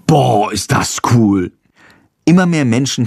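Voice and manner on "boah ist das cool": slow, wide-eyed boah; amazed boy. A narrator's reading. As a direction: Friedrich gapes at the swarm. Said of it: "Friedrich staunt". (langsam und staunend)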